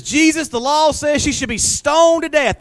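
slow, high, loud demand; accusing crowd. Acted. Accusers demanding Jesus enforce stoning, high and loud. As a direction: press Jesus hard, drawn out. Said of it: "It says".

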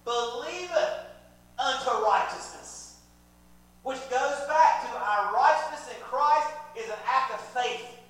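loud, high, emphatic preaching; full-force preaching. Real, not acted. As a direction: drive each phrase home to the congregation.

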